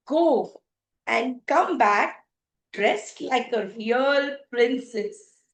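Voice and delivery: loud, halting, appalled complaint; disgusted scolding. Acted. Prince criticising the princess's appearance, scornful. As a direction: pick out each fault with emphasis.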